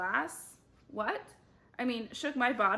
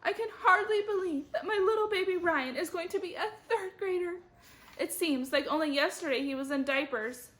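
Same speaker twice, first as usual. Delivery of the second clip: tearful; 9 semitones higher, 4 dB louder than usual. Acted. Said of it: "She started crying".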